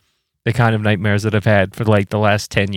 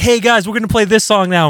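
a lightly voiced bandmate, higher and quicker; breezy higher bandmate voice, tossed off fast; 10 semitones higher, 3 dB louder, faster than usual. Bandmate casually announcing a surprise song, higher and quick.

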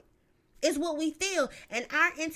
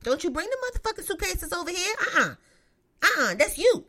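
sassy telling-off; 7 semitones higher, 5 dB louder than usual. Pretend. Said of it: "that's what the fuck I tell 'em".

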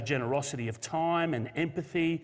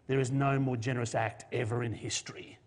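ordinary talk

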